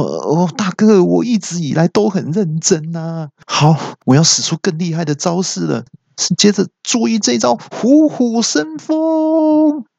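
a high-voiced, boastful kung-fu brute; boastful fighter. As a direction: announce the move with bravado. Fighter boasts, then announces move.

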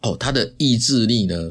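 ordinary delivery

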